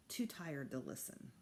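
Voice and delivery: soft unvoiced sleepy whisper; drowsy boy whispering he's too tired. A narrator's reading. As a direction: barely breathe it, half asleep. Half-asleep boy whispering.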